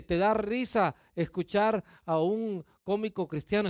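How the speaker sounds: ordinary talk, nothing marked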